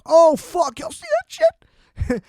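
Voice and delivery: very high-pitched, hammed-up fan excitement; mock freak-out, pitch shooting way up; hyped-up reaction. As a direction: squeal it like an over-hyped fan. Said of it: "hype it the fuck up".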